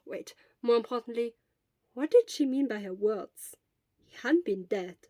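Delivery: ordinary delivery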